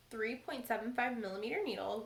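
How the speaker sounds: ordinary talk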